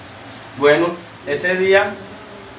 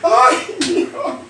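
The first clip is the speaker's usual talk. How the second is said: mock wailing mother; 3 semitones higher, much slower than usual. Old mother wails again.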